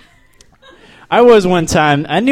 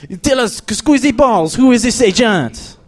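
higher-pitched, hammed-up French accent; French terrorist accent; 6 semitones higher than usual. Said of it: "That's a French terrorist".

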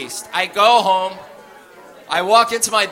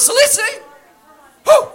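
much higher, disbelieving; incredulous squeal; 7 dB louder than usual. Retold or imitated. Arrested man asks the cellmates, squealing in disbelief.

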